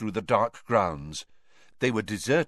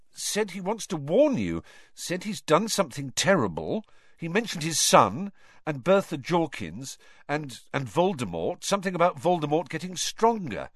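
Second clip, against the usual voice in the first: put-on young Harry; 7 semitones higher than usual. A narrator's reading. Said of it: "Harry reports to Dumbledore".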